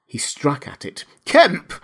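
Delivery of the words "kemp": high, loud cry of a name; cried out in alarm; urgent cry. A narrator's reading. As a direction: call out sharply to get through to him. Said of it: "cried the voice".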